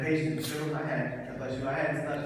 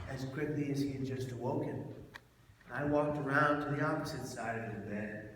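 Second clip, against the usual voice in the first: normal talking voice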